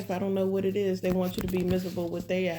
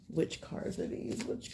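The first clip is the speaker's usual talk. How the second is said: whisper; 3 semitones lower, 9 dB quieter than usual. Real, not acted. Searching for missing tarot cards.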